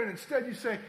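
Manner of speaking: ordinary talk, nothing marked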